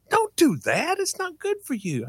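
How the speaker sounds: high, scolding mother; mom's voice. Retold or imitated. Mom scolding her son for sniffing gas, high. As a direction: warn the kid off like his mother.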